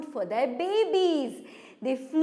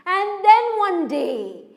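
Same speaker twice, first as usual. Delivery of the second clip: raised, louder storyteller announcement; dramatic story turn; 4 semitones higher, 6 dB louder than usual. A narrator's reading. Storyteller turning to the fateful day, raised and louder. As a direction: lift it suddenly out of the hush.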